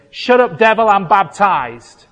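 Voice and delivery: imagined defiant outburst; Martin Luther rebuking. Acted. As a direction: tell the devil off forcefully. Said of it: "saying to him".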